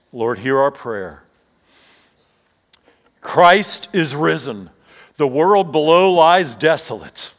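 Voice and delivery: steady, measured liturgical prayer voice; prayer reading. Real, not acted. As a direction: lead the church in solemn prayer. Leads a congregational prayer.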